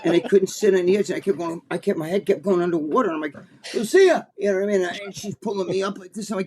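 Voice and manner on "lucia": calling out. Retold or imitated. Calling for help while drowning.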